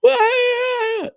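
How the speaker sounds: put-on a whiny girl's drawn-out start; mock whine.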